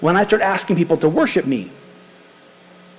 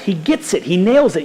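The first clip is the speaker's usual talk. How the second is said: ordinary delivery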